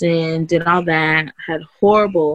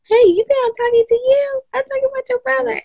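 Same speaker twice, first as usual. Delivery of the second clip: scolding aside; faster than usual. Real, not acted. Scolding a child mid-interview.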